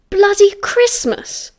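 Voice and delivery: high, slow, incredulous exclamation; shocked outburst. A narrator's reading. James shocked by how long the secret lasted, incredulous. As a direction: repeat it in outraged disbelief.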